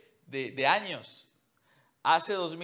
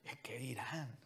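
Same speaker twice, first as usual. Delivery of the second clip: whispered; 4 semitones lower, 13 dB quieter than usual. Acted. Preacher mocking gossip to the congregation, whispered. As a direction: whisper it with scorn.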